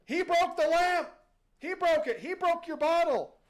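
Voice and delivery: high, loud tattling shouts; accusing crowd. Acted. Townsfolk rat out the boy to the genie, accusing shout. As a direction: yell it like a crowd pointing out the culprit. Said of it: "point and go".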